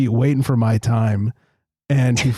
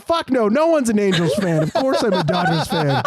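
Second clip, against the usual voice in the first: laughing taunt; 8 semitones higher than usual. Retold or imitated. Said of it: "I said".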